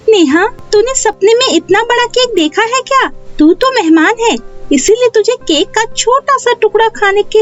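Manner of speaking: put-on high-pitched child character voice, gloating; boastful little girl. Pinky taunts her guest Neha about the cake, boastful high child voice.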